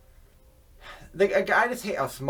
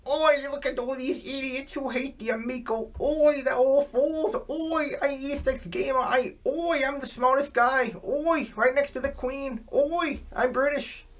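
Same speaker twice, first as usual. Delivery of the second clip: much higher, hammed-up mock British accent, slow and gloating; East Retro Gamer in a mock British accent; 12 semitones higher, slower than usual. Mocking a smug British YouTuber's gloating in a high, hammed-up British accent. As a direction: sneer through a cartoon British accent, pitched way up with a smug "oi". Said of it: "up there gloating".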